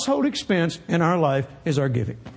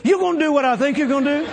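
higher excited repeat; 7 semitones higher, 3 dB louder, much faster than usual. Retold or imitated. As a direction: pitch jumps up, laughing.

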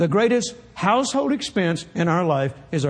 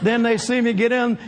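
ordinary talk

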